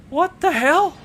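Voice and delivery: high, loud, incredulous cry; shocked. Retold or imitated. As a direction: burst out in disbelief, pitch way up. His shocked reaction to the tirade.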